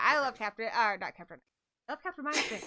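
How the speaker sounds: ordinary talk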